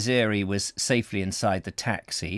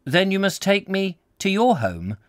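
high-pitched, urgent character voice; insistent suitor; 10 semitones higher, 4 dB louder than usual. Acted. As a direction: press the invitation in a much higher voice. Voicing insistent suitor in story.

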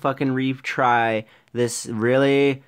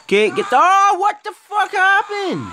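panicked shock. Real, not acted. Gamer caught by a monster, panicked yell. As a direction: shriek at the sudden attack. Killed suddenly by a creature.

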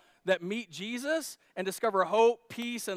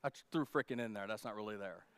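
much softer, lower sheepish aside; quiet aside; 8 semitones lower, 9 dB quieter, faster than usual. Real, not acted. Preacher owning up to the crowd, quiet and low. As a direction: drop it like a confession.

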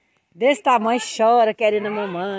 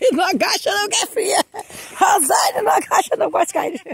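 high alarmed call; 9 semitones higher than usual. Real, not acted. Niece warning Rosário not to crouch on the cold floor, high alarmed call.

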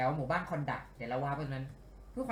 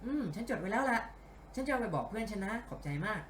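higher, put-on female character voice; note it down and thank them, voice lifted; เสียงพากย์นักสืบหญิง; 6 semitones higher than usual. (พากย์เสียงผู้หญิงสูงขึ้น กล่าวขอบใจ)

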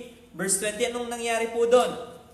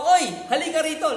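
loud, higher call across a yard; calling someone over; 7 semitones higher, 5 dB louder, faster than usual. Retold or imitated. Preacher acting out calling a friend over, loud call. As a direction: beckon a friend to come here. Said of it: "When we bid people to come".

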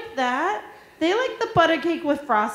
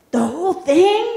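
put-on higher, louder astonished kid; surprised child; 5 semitones higher, 7 dB louder than usual. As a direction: gasp it out as the excited boy. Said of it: "He said".

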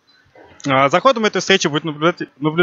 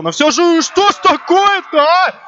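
high, amazed goal call; excited hockey goal call; 9 semitones higher than usual. Real, not acted. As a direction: exclaim in disbelief, pitch way up. Commentator calls third goal.

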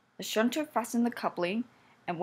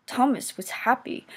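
plain reading voice

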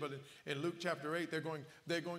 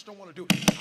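much louder, shouted at the crowd; preaching shout; 15 dB louder than usual. Real, not acted. Preacher hits a loud peak.

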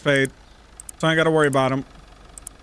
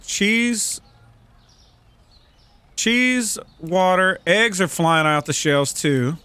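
ordinary talk

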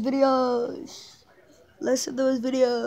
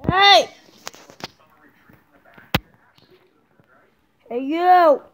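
loud call to a dog; 6 dB louder, slower than usual. Real, not acted. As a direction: call across the room to the pug. Calling the dog Morris.

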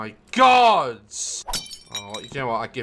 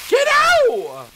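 much higher, louder yell; shout; 5 dB louder than usual. Real, not acted. Gamer shouting at a sponge in the pan, loud yell. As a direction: bark it out.

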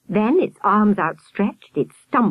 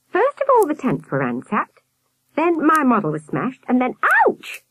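put-on high boy voice ending in a yelp; boy recounting, yelping; 8 semitones higher than usual. A narrator's reading. Albert listing mishaps until kicked, high yelp at end. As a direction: list it pitched up, then yelp.

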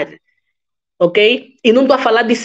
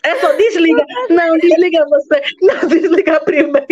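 higher, giggling lovers on the phone; giggly late night call; 6 semitones higher than usual. Pretend. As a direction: giggle through "you hang up first".

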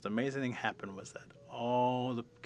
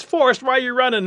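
very high, loud shouted question; urgent, climactic cry; rabbit calling out; 16 dB louder than usual. Acted. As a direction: holler up at a whole forest. Rabbit calls out to forest.

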